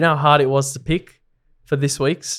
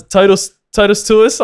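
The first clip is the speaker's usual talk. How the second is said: Latin accent; 7 semitones higher, 6 dB louder than usual. Shown as a demonstration. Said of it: "say it with the Latin accent".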